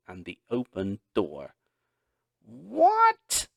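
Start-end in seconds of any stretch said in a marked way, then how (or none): 2.4-3.5 very high, loud startled "What?". A narrator's reading. Dad coming in from his run, startled and loud. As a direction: caught off guard at the door.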